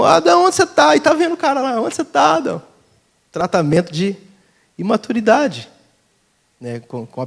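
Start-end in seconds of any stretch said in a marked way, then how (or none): 0.0-1.0 put-on lightly childlike, higher-pitched call; a hint of a big kid seeking; childish adult. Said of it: "Crianção". 1.8-2.6 put-on lightly childlike, higher call; a hint of a big kid seeking; childish adult. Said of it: "Crianção".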